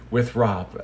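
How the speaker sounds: usual speaking voice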